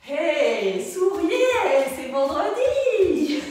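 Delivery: rally the group with a sing-out holler; cheerful shout. Real, not acted. Coach telling a tired class to smile for Friday, cheerful shout.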